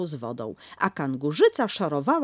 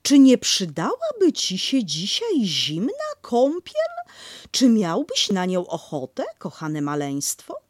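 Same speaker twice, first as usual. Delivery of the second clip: higher, louder coaxing mother, slowish; sweetly pushing a cold bath; Kanga offering a bath; 5 semitones higher, 6 dB louder than usual. Acted. Kanga to the baby, a higher coaxing mother.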